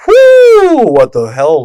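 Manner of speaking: high, loud whoop of amazement; excited. Real, not acted. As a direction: whoop it out in disbelief. Reacting to a touching lyric.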